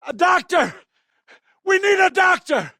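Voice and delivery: panicked shout, high and loud; shouting for help. Acted. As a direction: yell desperately for a doctor.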